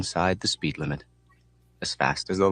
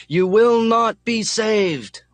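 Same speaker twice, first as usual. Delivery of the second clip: very high, very slow, loud scream; 13 semitones higher, 6 dB louder, much slower than usual. A narrator's reading. Mechanic screaming at a car coming head on. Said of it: "and the mechanic screams".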